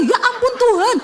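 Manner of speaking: a high, laughing cry of dismay; shocked exclamation. Retold or imitated. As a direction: gasp it out in disbelief. Reacts as the muffler falls off. (seruan kaget sambil tertawa)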